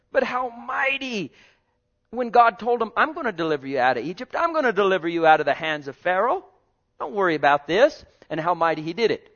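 high, driving sermon voice; fervent preaching. Acted. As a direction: press the story on them.

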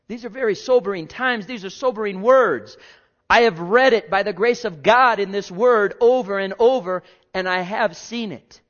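full-force preaching. Real, not acted. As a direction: declare it with full-voiced conviction.

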